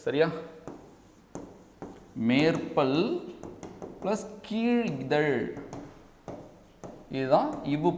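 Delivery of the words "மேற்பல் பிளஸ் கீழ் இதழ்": drawn-out words with a long pause between; slow dictation. Shown as a demonstration. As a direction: dictate it slowly for note-takers. Dictating notes slowly.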